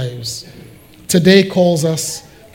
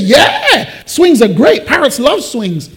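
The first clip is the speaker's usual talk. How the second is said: high, loud salesman's zeal; enthusiastic agreement; 8 semitones higher, 5 dB louder than usual. Acted. Shop manager selling a swing, enthusiastic. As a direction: sell it with bubbly conviction.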